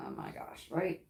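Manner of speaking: ordinary talk, nothing marked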